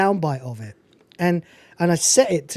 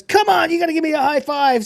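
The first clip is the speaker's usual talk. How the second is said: high, bright-eyed pushy coaxing; pushy high five demand; 9 semitones higher than usual. Retold or imitated. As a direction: egg him on like an overeager buddy. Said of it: "he goes".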